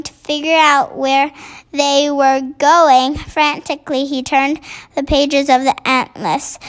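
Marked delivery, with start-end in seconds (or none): none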